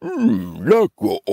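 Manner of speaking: troll grumble jumping up in pitch; grudging hum, then give in; grumbling troll. Acted. Troll letting the little goat pass, grudging grumble. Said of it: "brummede trolden".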